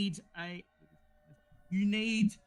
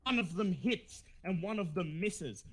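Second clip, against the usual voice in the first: normal talking voice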